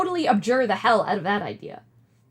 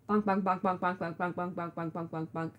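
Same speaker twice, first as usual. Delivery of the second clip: bonk sounds; 6 semitones lower, 8 dB quieter than usual. Retold or imitated. Rose hitting John with box.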